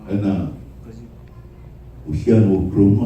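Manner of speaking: ordinary talk, nothing marked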